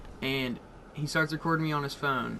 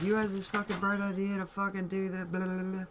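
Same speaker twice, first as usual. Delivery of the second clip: mock whiny gripe; 5 semitones higher than usual. Imitating the friend's griping.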